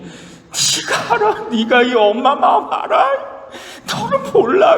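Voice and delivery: a mother's choked cry of frustration; mother's anguish. Retold or imitated. Mother crying out to her son while weeping, high strained outcry. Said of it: "그러더니".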